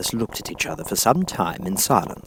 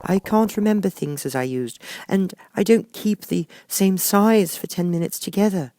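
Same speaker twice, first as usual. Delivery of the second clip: high, lightly voiced girl; a young girl complaining; Alice; 11 semitones higher than usual. A narrator's reading. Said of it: "said Alice".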